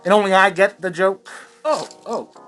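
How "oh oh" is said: usual speaking voice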